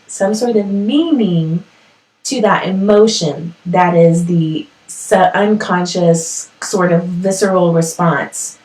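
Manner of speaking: slow, deliberate restatement; slow and clear. Real, not acted. Host saying it again so listeners follow, speaking slowly. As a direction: spell the idea out unhurriedly, word by word. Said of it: "I'm speaking slowly, so I knew I could be clear".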